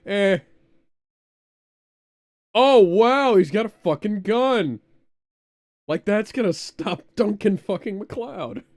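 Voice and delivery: high, loud excited disbelief. Real, not acted. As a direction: shout it out, wound up and amazed. Reacting to gun in game.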